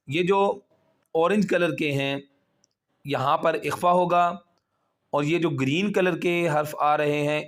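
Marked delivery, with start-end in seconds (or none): none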